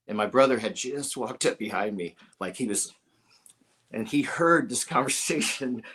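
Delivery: ordinary delivery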